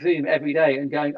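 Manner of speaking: ordinary talk, nothing marked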